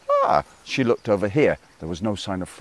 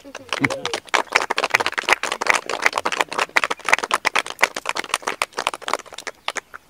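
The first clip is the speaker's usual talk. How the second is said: high breathy giggle; high frog giggle. Acted. Frog laughs at end.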